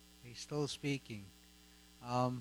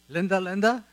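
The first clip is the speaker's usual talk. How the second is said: calling out; 5 semitones higher, 14 dB louder than usual. Real, not acted. Calling Linda up to testify.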